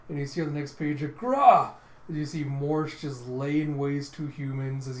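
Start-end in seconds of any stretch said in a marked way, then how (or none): 1.0-1.7 loud, high "gra" roar; monster roar. Acted. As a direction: roar out an attack noise. Sound effect of the attack.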